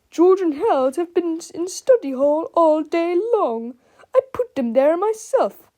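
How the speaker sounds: high, loud indignant voice; exasperated protest. A narrator's reading. Principal insists the boys were in study hall, loudly exasperated. Krupp protests after the disaster.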